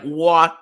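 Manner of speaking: "wah" as a drawn-out, open Boston O; Boston O sound. Shown as a demonstration. Explaining Boston vowels, long exaggerated "wah". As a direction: stretch "wah" wide for the O. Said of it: "all of their O's are".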